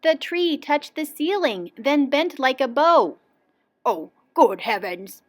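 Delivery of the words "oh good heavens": mildly shocked exclamation; gasped. A narrator's reading. Willoughby sees the bent tree, gasping mildly. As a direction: gasp it with a touch of dismay. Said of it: "he gasped".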